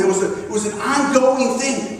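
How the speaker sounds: ordinary talk, nothing marked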